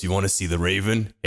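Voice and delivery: low, creepy video-game NPC voice; Dark Souls NPC. Acted. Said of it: "[name] is a fucking Dark Souls N P C".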